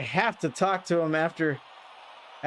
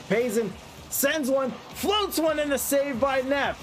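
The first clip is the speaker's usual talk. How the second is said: rising, building play-by-play call; live play-by-play; 6 semitones higher than usual. Acted. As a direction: call the match as it unfolds, climbing with the action.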